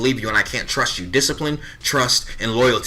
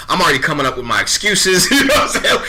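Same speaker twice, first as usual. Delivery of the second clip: laughing through the words; 3 semitones higher than usual. Real, not acted. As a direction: chuckle at your own panic. Laughing at himself.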